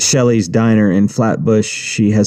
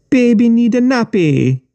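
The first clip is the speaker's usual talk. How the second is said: put-on much higher, sing-song baby taunt; taunting thug; 14 semitones higher than usual. A narrator's reading. Ren Dog taunting the wet boy, high and sing-song. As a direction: coo it like mocking a baby.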